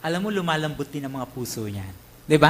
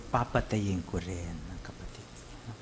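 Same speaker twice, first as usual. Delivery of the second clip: menacing threat; 8 semitones lower, 6 dB quieter than usual. Retold or imitated. Feud revenge threat.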